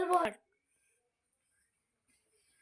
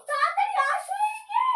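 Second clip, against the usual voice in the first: higher shout across the house; 10 semitones higher, 4 dB louder than usual. Acted. Mother calling father to come see the boy, high shout. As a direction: call out urgently. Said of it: "আরে এত চিৎকার করছো কেন?".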